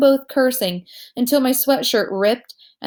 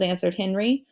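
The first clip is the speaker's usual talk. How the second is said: plain reading voice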